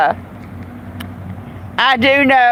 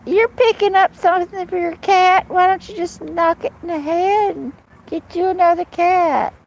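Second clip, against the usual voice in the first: mocking townsfolk; 7 semitones higher than usual. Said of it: "people at the pharmacy were like".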